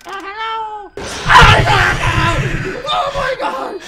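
frantic high-pitched panic; panicked. Real, not acted. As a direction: squeal in real terror.

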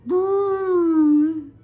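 long, loud "boo"; playful scare. Acted. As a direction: draw out a high, loud boo. Acting out the scare.

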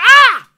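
sudden high loud shriek; startled shriek; panicked shriek. Shown as a demonstration. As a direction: shriek as if nearly losing control. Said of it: "It's a shriek".

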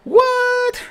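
high-pitched yelp of disbelief; shocked. Real, not acted. Gamer to himself at a sudden setback, shocked. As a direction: blurt it out, startled and high. Shocked mid-game reaction.

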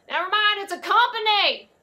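high, loud holler to someone off; yelling across. Acted. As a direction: shout the correction across the lot. Yells correction to Bobby Ray.

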